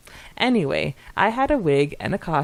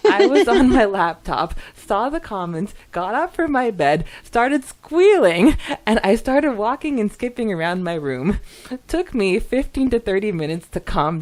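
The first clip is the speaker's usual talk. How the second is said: excited retelling; 4 semitones higher, 7 dB louder than usual. Retold or imitated. Reading a fan's comment to co-host, excited and rising. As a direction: voice climbing higher and louder, gushing.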